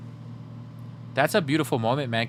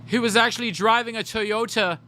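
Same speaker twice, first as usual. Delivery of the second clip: higher snooty sneer; playfully posh put-down; lightly voiced rich snob; mock rich gossip; 7 semitones higher than usual. Mocking gossip as rich person.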